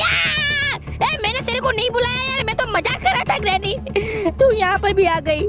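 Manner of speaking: startled high protest; high mock panic. Gamer caught by Granny, high panicked protest.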